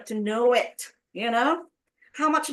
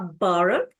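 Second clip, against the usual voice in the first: slow, firm "borrow", strongest on BOR; teacher's quiz demo of "borrow", stressed on BOR; stress on first syllable; 3 semitones lower, 4 dB louder than usual. Shown as a demonstration. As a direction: hit BOR in "borrow", ending on a rise. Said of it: "First syllable".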